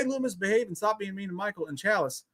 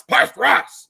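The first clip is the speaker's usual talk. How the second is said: louder, higher call; 7 semitones higher, 9 dB louder, much slower than usual. Shown as a demonstration. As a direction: call it out across the table. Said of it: "repeated with a louder take".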